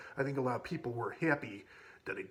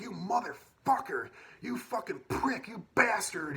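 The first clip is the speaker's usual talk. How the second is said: high-pitched, drawn-out cursing; pissed off; 9 semitones higher, slower than usual. Retold or imitated. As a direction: imitate an outraged girl, slow, high and strained. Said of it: "she got so pissed".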